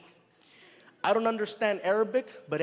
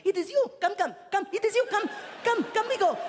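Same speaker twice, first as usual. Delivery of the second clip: excited greeter; 12 semitones higher than usual. Retold or imitated. A driver holding a sign greets the comedian, eager and excited. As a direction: beckon him eagerly, repeating come. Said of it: "he's really excited".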